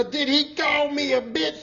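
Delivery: high, slow indignant challenge; sassy indignant woman. Retold or imitated. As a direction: lean into the sass of the question. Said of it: "She had that vigor".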